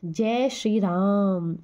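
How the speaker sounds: राम with the vowel आ drawn out long and slow; stretched राम. Shown as a demonstration. Teacher showing students a prolonged vowel, slowly stretched. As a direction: pull राम out slowly, holding the आ. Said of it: "राम ऐसे नहीं बोला".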